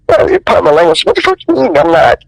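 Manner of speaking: high-pitched, indignant comeback; retold indignant challenge. Actor retells challenging the director who mocked him, indignant. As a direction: act out taking offence, pitch jumping up.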